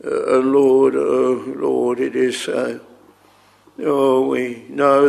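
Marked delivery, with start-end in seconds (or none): none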